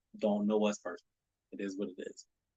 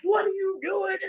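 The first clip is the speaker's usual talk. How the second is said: much higher, incredulous question; mock baffled friend. Friends at the party asking why he changed, high and baffled. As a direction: play the confused onlooker, pitched way up. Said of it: "Everyone is like".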